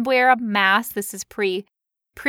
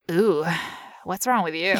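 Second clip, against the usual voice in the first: low, softer mock-disgusted question; low, judging "ooh" comeback; pointed disdain; 6 semitones lower, 4 dB quieter than usual. Shown as a demonstration. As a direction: point and ask it low, looking them over. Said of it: "you're just like".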